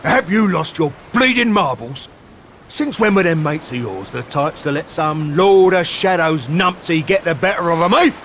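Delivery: exasperated scolding. Acted. Companion scolding Sylve for losing faith, exasperated. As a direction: shake a moping friend out of it.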